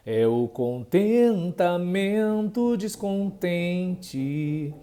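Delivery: very slow, leaning on the "tem" of "contentamento"; stress on tem. Shown as a demonstration. Teacher showing the strong syllable, slowly stressing "tem". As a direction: draw the line out slowly and hit "tem". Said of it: "O tem tá mais forte".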